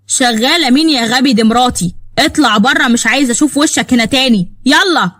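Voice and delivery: acted indignant husband; angry dismissal. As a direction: throw him out in anger. Husband throws the harasser out.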